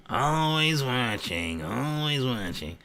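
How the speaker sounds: slow, lightly creepy watcher voice; impression of Sarah. Streamer imitating Sarah watching the chat, slow and eerie. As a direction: drag it out like someone always watching. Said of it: "That's my impression of Sarah".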